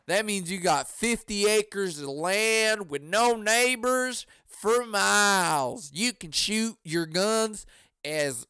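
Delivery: put-on slow, stretched Southern US accent; mock Southern drawl. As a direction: boast like a Southern country character, drawing words out. Mimics a rural Southerner boasting.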